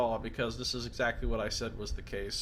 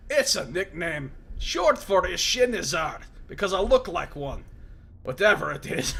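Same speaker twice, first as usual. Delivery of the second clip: loud, raised voice of a wounded prisoner; gruff Mazov; 5 semitones higher, 5 dB louder than usual. Acted. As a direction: bark it at the intruder in surprise.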